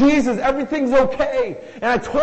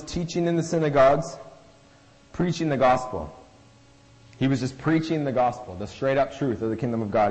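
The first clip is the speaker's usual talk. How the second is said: measured, lower Bible reading voice; scripture reading; 6 semitones lower than usual. Acted. Reads scripture aloud to congregation.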